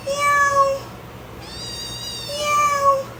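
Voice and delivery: high mock cat meow. Meowing back at the cat.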